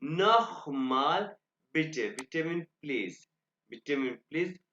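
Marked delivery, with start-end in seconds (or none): 0.0-1.2 नोखमाल said very slowly with a rasping kh; throaty kh sound. Shown as a demonstration. Teacher showing students the kh in नोखमाल, slowly. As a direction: stretch नोखमाल, scraping the kh in the throat. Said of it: "खे की आवाज निकालेगा".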